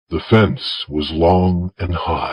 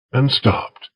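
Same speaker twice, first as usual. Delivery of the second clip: usual speaking voice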